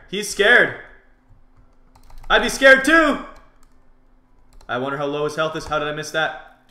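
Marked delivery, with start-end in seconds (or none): 0.1-3.2 high, loud jeer climbing; crowing taunt. Real, not acted. Player jeering a fleeing opponent, crowing. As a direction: crow louder and higher at their fear.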